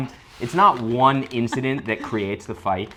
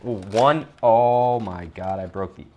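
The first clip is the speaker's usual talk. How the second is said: usual speaking voice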